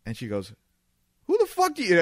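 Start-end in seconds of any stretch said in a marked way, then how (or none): 1.3-2.0 high, loud furious shriek; going nuts. Retold or imitated. Gabby blowing up at the lawyer at the bar, shrieking. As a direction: blow up at him, way up high. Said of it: "Gabby starts, like, going nuts".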